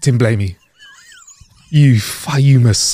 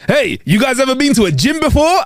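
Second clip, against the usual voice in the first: mimicked waiter; 9 semitones higher than usual. Retelling the waiter's comment.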